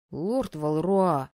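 slow, hesitant address; unsure call. A narrator's reading. Виктор wants to object to the dean, hesitant. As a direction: draw it out tentatively. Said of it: "неуверенно позвал Виктор".

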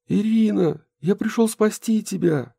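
put-on high eager boy's voice; young boy voice. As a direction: voice him as a brave young boy. Boy hero voiced in story.

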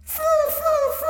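very high tiny squeak, slow and soft; squeaky rat. A narrator's reading. Death of Rats squeak.

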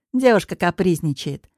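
ordinary delivery

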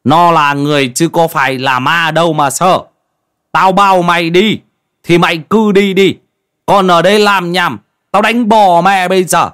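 loud, high-pitched angry scolding; irate old man losing his temper; furious. A narrator's reading. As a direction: yell threats at a cowering youngster. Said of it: "Ông Măng cáu tiết".

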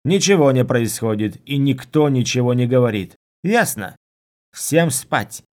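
loud commander's bark, rising in pitch at the end; barked orders. A narrator's reading. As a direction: shut everyone down with a loud order. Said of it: "рявкнула Халлинс".

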